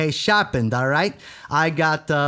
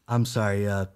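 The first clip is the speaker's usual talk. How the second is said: usual speaking voice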